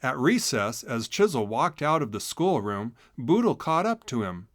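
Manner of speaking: ordinary delivery, nothing marked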